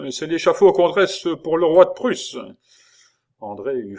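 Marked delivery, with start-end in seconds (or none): 0.1-2.4 loud, high, cocky bravado; swaggering. A narrator's reading. Jailer brags to André about the scaffold, loud swagger. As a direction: boast it to hide the fear. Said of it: "Et d'un air fanfaron".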